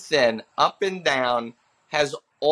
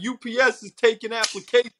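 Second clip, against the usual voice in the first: raised-pitch heckler jab; front-row heckler; 6 semitones higher than usual. Retold or imitated. Dude in the front row to a bombing comic, heckling. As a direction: needle the comic from the crowd in a higher voice. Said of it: "there was a dude in the front row".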